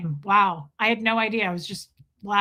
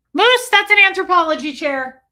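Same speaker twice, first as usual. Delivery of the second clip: louder, higher call to the pet; sharp, raised voice at the pet; scolding pet; 7 semitones higher, 7 dB louder than usual. Real, not acted. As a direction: tell the cat off from across the room.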